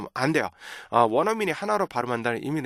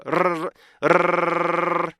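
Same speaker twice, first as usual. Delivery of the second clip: long rolled R, higher and steady; sustained tongue trill; 4 semitones higher than usual. Shown as a demonstration. Teacher demonstrating tongue movement, extended trill. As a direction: roll the tongue continuously on the breath. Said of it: "혀가 왔다".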